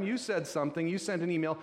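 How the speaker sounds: usual speaking voice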